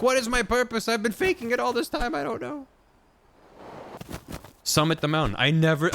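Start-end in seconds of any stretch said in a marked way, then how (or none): 0.0-2.7 high-pitched fretting character; mock existential crisis. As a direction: whine it way up high, in mock despair. Acts out a golem's purpose crisis.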